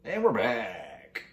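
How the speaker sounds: drawn-out announcer's comeback; slow, showy "we're back"; mock show-host return. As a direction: stretch it out like a host back from a break.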